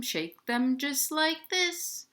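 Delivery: usual speaking voice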